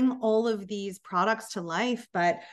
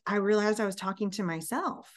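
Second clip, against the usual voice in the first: normal talking voice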